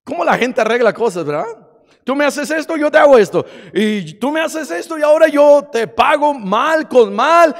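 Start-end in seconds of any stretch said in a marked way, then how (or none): 2.0-3.4 higher and louder, jabbing back and forth; acting out both sides of a petty fight; tit-for-tat quarrel. 3.7-7.5 louder and higher, hitting mal hard; mock feuding voice punching mal; spiteful retaliation. Acting out tit-for-tat revenge.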